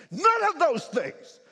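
slow, loud, high; emphatic. Real, not acted. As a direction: hammer the point home. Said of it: "emphatic sermon point".

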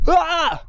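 short high-pitched yelp of a scream; half-hearted scream. Shown as a demonstration. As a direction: let out a brief, held-back scream. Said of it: "warte, ich schreie mal".

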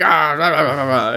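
mock villain rant; lightly voiced cartoon villain blather; weird villain. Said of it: "he just sounded like a weird villain".

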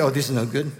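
usual speaking voice

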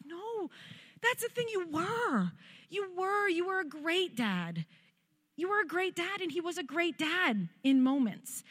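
tearful reassurance. Retold or imitated. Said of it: "I was like".

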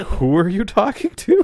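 high-pitched, mildly alarmed question; mock concern. Startled partner questions an unhinged caller, concerned. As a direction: ask it high, with comic worry. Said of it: "[name] is just very concerned".

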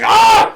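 loud, high re-enacted cry of pain; pained yelp. Re-enacting pulling on himself by accident, loud pained yelp. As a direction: yelp as if something got yanked.

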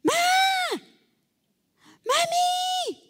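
put-on high, drawn-out child's call to mommy. Little girl in bed calling her mom in the kitchen, high call. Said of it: "Yo decía".

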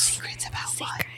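very soft, urgent whisper; worried whisper. Real, not acted. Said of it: "Why are we whispering?".

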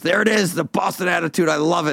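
high-pitched crowing of an amused onlooker; imitated delight. Delighted onlooker in retold bar exchange.

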